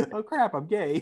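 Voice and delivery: high laughing repeat; laughing quote. Retold or imitated. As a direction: laugh it out while quoting. Laughing while repeating friend's line.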